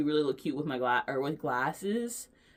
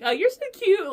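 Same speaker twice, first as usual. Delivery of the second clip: much higher, much louder squeal; squealing compliment; 10 dB louder than usual. Retold or imitated. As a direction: squeal it back with delight. Clerk gushing back.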